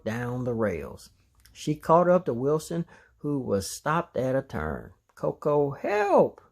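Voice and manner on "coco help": panicked call to a friend; cry for help. A narrator's reading. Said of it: "Wilson cried".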